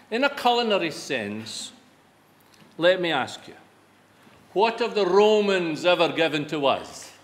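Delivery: normal talking voice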